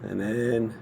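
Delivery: ordinary talk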